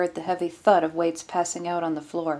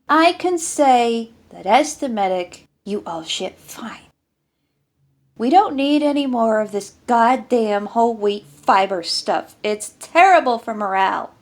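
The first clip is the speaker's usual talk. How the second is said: a loud, higher, grumbling army medic; gruff medic rant; 4 semitones higher, 7 dB louder than usual. Acted. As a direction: complain to the squad like a fed-up field medic. Medic rants about whole wheat.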